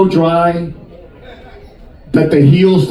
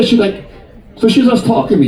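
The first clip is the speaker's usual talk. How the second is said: normal talking voice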